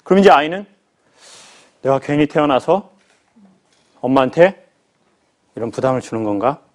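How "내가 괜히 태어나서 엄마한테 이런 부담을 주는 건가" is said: put-on child's sad thought. Child's hurt inner thought.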